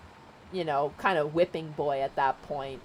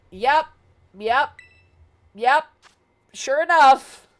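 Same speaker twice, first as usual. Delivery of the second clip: each yep louder and higher; rising excitement; 6 semitones higher, 8 dB louder, slower than usual. Real, not acted. Streamer builds to the punchline for chat, louder and higher. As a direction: build the yeps up to a loud sure enough.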